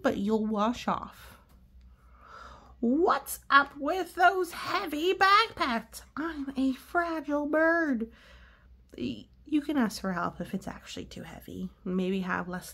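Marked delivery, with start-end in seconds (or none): 2.8-8.1 high, fretful bird voice; worried cartoon pigeon asking. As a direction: play the anxious pigeon, pitched up. Adult voices Pigeon in story.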